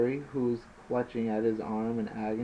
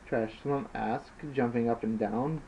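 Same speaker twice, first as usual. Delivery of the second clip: usual speaking voice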